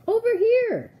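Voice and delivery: very high, loud holler; urgent high-pitched call. A narrator's reading. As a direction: shout to guide someone to you. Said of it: "the voice called out".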